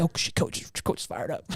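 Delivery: hushed player whisper; whispered aside. Retold or imitated. Player whispering to teammate about the coach, hushed.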